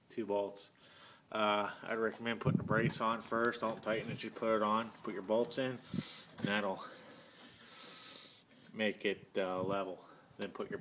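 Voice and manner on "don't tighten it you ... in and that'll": ordinary talk, nothing marked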